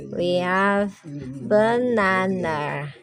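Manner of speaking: slow, careful banana, buh-NAH-nah; model word banana. Shown as a demonstration. Teacher to class, modelling banana slowly. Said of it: "Everybody, banana".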